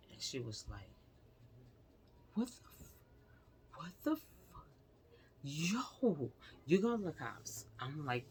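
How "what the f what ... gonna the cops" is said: high-pitched, drawn-in disbelief, imitating a stunned sister; retold shocked reaction. Sister reacting to what she was just told, imitated shock. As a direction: act out a sister's rising shock.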